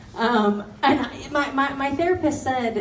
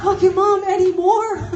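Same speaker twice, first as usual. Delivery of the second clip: loud high indignant protest; louder and higher incredulous; outraged; 8 semitones higher, 8 dB louder than usual. Retold or imitated. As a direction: shout it in disbelief. Outraged reply to mom.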